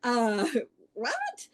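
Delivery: high, puzzled "what was that"; surprised. Retold or imitated. Retelling her confused reply on the phone, quietly puzzled. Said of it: "I'm like".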